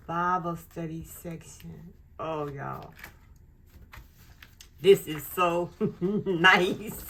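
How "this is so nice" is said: slow, loud with a laugh in it; real giggly delight. Real, not acted. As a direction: laugh through the words, delighted. Gushing over the planner.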